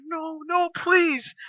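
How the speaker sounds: high, soft begging; pleading. Retold or imitated. Hurt wrestler begging the attacker to stop, high and pleading. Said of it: "And Money's like".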